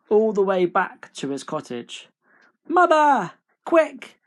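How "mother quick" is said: put-on high, loud boyish yell; Jack's high-pitched alarm; urgent shout. A narrator's reading. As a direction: shout for help in a hurry. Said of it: "he shouted".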